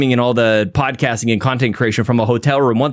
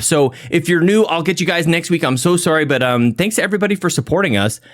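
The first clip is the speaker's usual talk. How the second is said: ordinary talk, nothing marked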